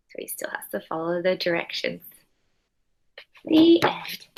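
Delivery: soft closing whisper; hushed storybook ending. Real, not acted. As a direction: whisper the last words of the book.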